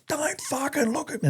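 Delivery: strained, high-pitched drill yell without full volume; high, held-back imitation of a parade-ground yell; mock bellowed order. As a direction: imitate a corporal roaring at a recruit. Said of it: "it would echo through the whole fucking platoon".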